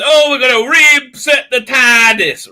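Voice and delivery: high, slow mock Doctor voice; lilting parody of the Doctor. Mocking the Doctor's line to the listeners. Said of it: "so she says".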